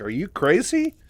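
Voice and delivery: high, loud incredulous question; still reeling. Retold or imitated. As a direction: imitate someone stunned, voice leaping up in disbelief. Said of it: "still reeling from all the info before".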